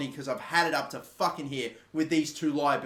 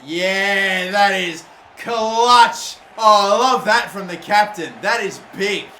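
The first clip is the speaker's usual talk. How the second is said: loud drawn-out cheer; real delight, stretched slowly; excited shout; 2 semitones higher, 11 dB louder, slower than usual. Real, not acted. Gamer cheering his captain's clutch tackle, loud and slow.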